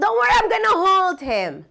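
imitated host calling reassurance, high; high-pitched reassurance. Dog owner holding his dog, calling reassurance to the scared visitor. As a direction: reassure someone from afar, pitched well up.

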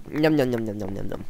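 playful munching sounds; mock eating noises. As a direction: say num num like chewing. Pretend eating the golden apple.

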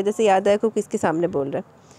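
ordinary delivery, nothing marked

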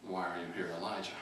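hushed and gentle, like a whisper. Acted. God's gentle whisper to Elijah, low and soft. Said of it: "the sound of a gentle whisper".

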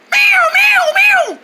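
high repeated siren wail; shrieking bird distress call, like a fire alarm; alarm-like bird cry. Acted. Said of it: "It sounded like a fire alarm".

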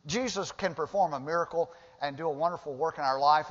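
normal talking voice

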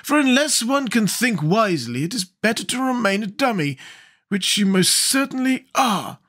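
high-pitched, severe lecture. A narrator's reading. Scarecrow calls Jack a dummy, severely. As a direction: admonish sternly, voice pitched high. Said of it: "returned the Scarecrow severely".

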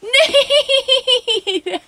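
high, laughing shout of a name; laughing call. Real, not acted. Finds hair, calls to partner.

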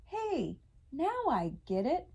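high, swooping elf exclamation; grinning delight. A narrator's reading. Schmelf the elf discovers Hanukkah, high grinning delight. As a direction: let the pitch leap with a delighted grin. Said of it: "said with a grin".

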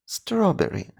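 whispered strawberry, high and soft. Shown as a demonstration. Teacher whispering the last echo for kids. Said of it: "Потренуємо це слово".

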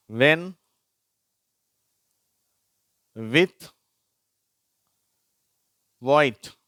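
teacher modelling wh words; wh as w demo. Shown as a demonstration. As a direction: read each word clearly with pauses. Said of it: "அந்த வார்த்தைகளை நான் வாசிக்கிறேன்".

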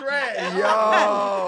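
loud, high laughing yo. Real, not acted. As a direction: bark it out mid-laugh. Group cracks up.

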